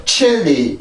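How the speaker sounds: 칠리 as two separate Korean syllables, chil-li; wrong pronunciation demo. Shown as a demonstration. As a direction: say 칠리 with no glide before the L. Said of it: "이게 아니라". (칠리를 한국어식 '칠-리'로 발음)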